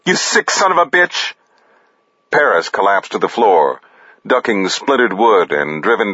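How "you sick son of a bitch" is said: high-pitched outraged cry; furious accusation. A narrator's reading. Jay storming the room at Paris, furious.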